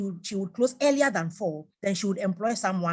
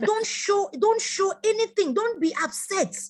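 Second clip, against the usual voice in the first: urgent advice; 12 semitones higher, 4 dB louder than usual. Real, not acted.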